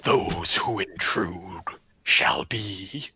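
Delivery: loud, low, slow booming threat; booming monster voice. Acted. Creature threatens intruders in a booming voice. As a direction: boom each phrase out, heavy and slow. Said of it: "a loud, booming voice that shakes".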